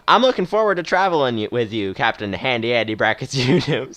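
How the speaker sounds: put-on Western old-timer, amused at the end; Western accent. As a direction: play the Western captain, nearly laughing at the name. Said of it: "doing a sort of Western accent".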